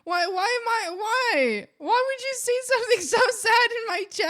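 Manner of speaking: high, loud hammed-up protest; mock outraged yell. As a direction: yell at chat in mock despair, laughing. Repeats the mock outrage louder.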